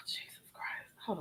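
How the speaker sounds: breathy sigh then whisper; whispered exasperation. Real, not acted. Reactor whispers in disbelief at the drama.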